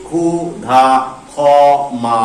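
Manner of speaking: ক্ষুধা, খ মা as khu-dha, kho-ma; syllable-by-syllable examples. Shown as a demonstration. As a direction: separate each syllable of ক্ষুধা, খ মা with pauses. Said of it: "যেমন হচ্ছে".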